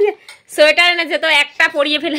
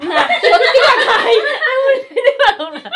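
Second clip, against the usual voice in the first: laughing; 7 semitones higher, 4 dB louder than usual. Real, not acted. Mother laughs refusing family's teasing, laughing. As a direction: giggle it out, can't keep a straight face.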